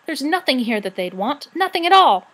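building to a loud high protest; rising cry. A narrator's reading. Sorrel insisting to the rat, building to a cry. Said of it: "she cried".

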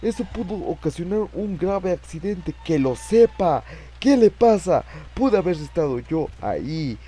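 high, agitated scolding; indignant rant. Real, not acted. Player scolding whoever caused the scare, indignant rant. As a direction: protest loudly, still shaken by the fright.

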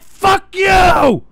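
furious shout. Real, not acted. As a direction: spit it at them. Yelling at chat.

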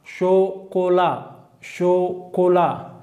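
slow modelled şokola; French model word. Shown as a demonstration. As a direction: drill şokola twice, slow and high. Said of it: "Çikolata".

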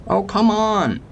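high-pitched exasperated cry, stretching come; frustrated yelp at the screen; annoyed outburst. Real, not acted. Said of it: "I'm just getting a bit annoyed".

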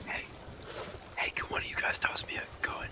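hushed whisper to teammates. Real, not acted. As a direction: whisper the request under breath. Caged player asks quietly.

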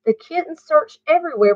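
ordinary delivery, nothing marked

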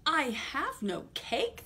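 slow little rabbit reply; timid rabbit. Acted. The rabbit answering the highway rat, lightly timid. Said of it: "the rabbit replied".

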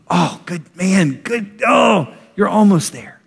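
slow, high, excited cheer; a proud father cheering, high and slow; cheering encouragement. Retold or imitated. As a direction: cheer them on as they near the goal. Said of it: "the true voice of encouragement".

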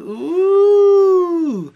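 high, loud, held "ooh"; long ooh. Real, not acted. After watching someone talk rudely, long high ooh. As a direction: draw out a high hoot. Reacting to rude clip.

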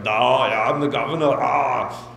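put-on Austrian accent, low and loud; mock the governor's thick Austrian accent; Schwarzenegger impression. Imitating the governor's Austrian accent for a big crowd. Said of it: "He's like".

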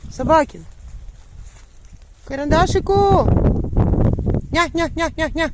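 high, loud call across the yard; calling the dog. Real, not acted. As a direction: call your dog from far away. (голосно кличе собаку)

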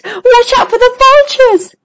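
high, gulping warning; a scared zebra swallowing hard mid-warning. Acted. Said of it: "she gulped".

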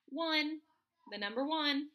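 ordinary talk, nothing marked

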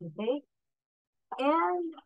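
usual speaking voice

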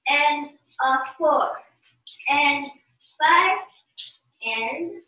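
slow dance count with long gaps; unhurried teacher's count, pausing between beats. Shown as a demonstration. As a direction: count out the beats slowly, one by one.

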